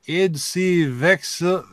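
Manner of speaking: slow, solemn Latin chant; preaching in Latin. Pretend. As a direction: intone it like a priest. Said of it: "Start preaching in Latin".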